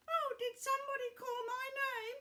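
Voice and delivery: put-on high, sweet old lady voice; grandma voice. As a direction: play the chirpy grandma. Grandma puppet voice enters.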